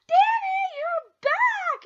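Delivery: put-on high, loud, excited child's greeting; excited kids. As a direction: greet like a delighted little kid. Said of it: "they go".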